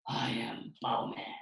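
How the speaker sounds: slow, hoarse whispered hero; superhero voice. Shown as a demonstration. Showing pupils how to read Bao Man, slow whispery hero voice. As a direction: rasp it out slowly, dead serious. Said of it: "you gotta read it in that voice".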